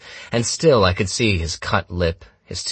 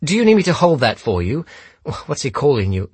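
high, raised-voice taunt; needling question; 9 semitones higher, 4 dB louder, faster than usual. A narrator's reading. Holmes to the gunman's daughter with the phone, needling. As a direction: offer help mockingly, pitched up high.

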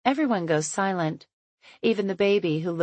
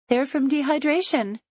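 cheerful woman; 9 semitones higher than usual. A narrator's reading. Chloe voiced, cheerful.

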